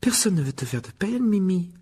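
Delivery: high-pitched, earnest reassurance; reassuring. Acted. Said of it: "assura Hermione". (rassurant, d'une voix plus aiguë)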